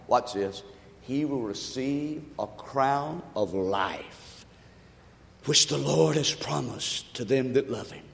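soft, slow verse recital; soft preacher reading the verse again; quiet scripture. Acted. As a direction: hush it, read it slowly.

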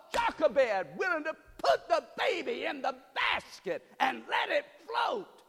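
shouted, high preaching with laughs; full-throated gospel preaching; preaching at full force. Acted. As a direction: lift the congregation, shouting it out.

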